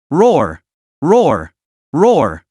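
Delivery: earth-shaking bull roars, one after another; furious roars. A narrator's reading. The wounded Minotaur roars at the angel, furious roars. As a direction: roar in blind rage. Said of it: "Amidst the earth-shaking roar".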